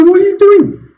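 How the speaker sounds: high-pitched, incredulous objection; retold startled protest. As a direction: recount it as a shocked squeal of protest. Retells recoiling from hand kiss.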